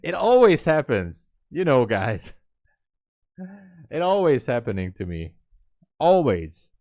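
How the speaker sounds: loud exasperated grumble; exasperated outburst. Real, not acted. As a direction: vent about bad luck to viewers. Trade missed by one tick.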